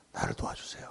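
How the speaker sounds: very soft, near-whispered prayer; humble plea. Acted. Preacher models asking God for help, hushed and humble. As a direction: let the voice drop away into a hushed plea.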